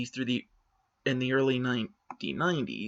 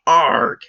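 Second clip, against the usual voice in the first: frustrated growl; 10 dB louder than usual. Acted. Frustrated outburst mid-argument.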